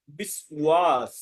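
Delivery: slow "বিশ্বাস" as bis-waas; Sanskrit pronunciation. Shown as a demonstration. Teacher recapping the rule, "বিশ্বাস" slowly as biswaas. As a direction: draw out the wa in "বিশ্বাস". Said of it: "এরকম উচ্চারণ হবে".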